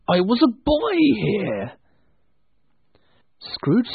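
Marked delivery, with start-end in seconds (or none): 0.1-1.7 wistful delight. Acted. Scrooge moved by old home.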